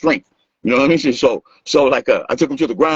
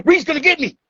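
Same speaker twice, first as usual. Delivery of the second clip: high, whiny little-brother voice; imitating a frightened runaway, pitched up; mock scared whine. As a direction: play the kid fleeing in mock panic. Ivan running away, mocked.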